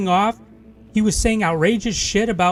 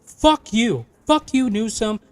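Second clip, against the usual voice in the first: angry tirade; 2 semitones higher than usual. Real, not acted. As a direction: fling every fuck you at them. Cursing out politicians.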